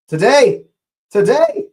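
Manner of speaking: loud, climbing to a very high cry; excited shout. Real, not acted. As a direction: yell it at the screen. Mock-chanting at the screen.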